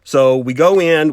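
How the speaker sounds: ordinary delivery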